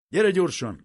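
shouting for his wife to hurry. A narrator's reading. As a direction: yell across to the next room. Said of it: "kiáltott át a feleségének".

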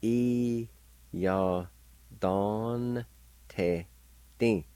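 E, ya, don, te, ti each said apart; teacher sounding out E ya don te ti; syllable by syllable. Shown as a demonstration. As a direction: pause after each part of E ya don te ti. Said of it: "like this".

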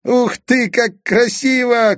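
put-on high, slow, delighted boyish cry; boy shouting in wonder; excited shout. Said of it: "крикнул Казик". (восторженный крик мальчика)

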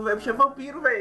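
excited joke. Acted. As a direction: pitch it up in excitement. Joking Temer is a vampire.

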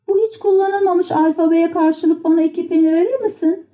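high puppet voice, bargaining; Pinokyo. A narrator's reading. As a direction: make the offer in a high childlike pitch. Pinokyo para istemeyi sürdürüyor. (pazarlık eden tiz kukla sesi)

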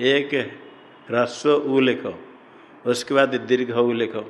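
normal talking voice

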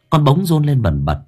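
plain reading voice

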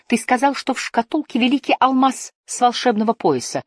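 high-pitched, persistent accusation; insistent protest. A narrator's reading. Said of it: "не унимался Лив". (настойчивый протест на повышенных тонах)

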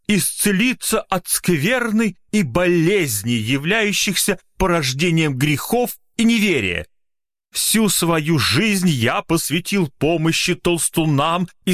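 high, slow grand orator; pompous magician. Acted. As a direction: preach it like a showman healer. Magician addresses hall. (напыщенный голос мага)

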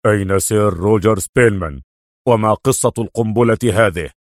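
low-voiced questioning inspector; British police inspector. A narrator's reading. Inspector asking Adham about the bomb, low official voice. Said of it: "ينفث دخان غليونه في إسراف وهو يقول".

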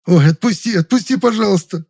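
high, loud, yelping plea; wailing plea. A narrator's reading. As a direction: wail for mercy in pain. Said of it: "заблажил он".

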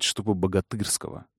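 ordinary delivery

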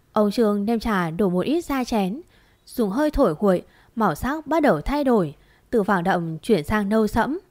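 plain reading voice

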